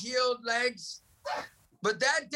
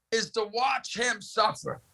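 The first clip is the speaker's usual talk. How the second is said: ordinary talk, nothing marked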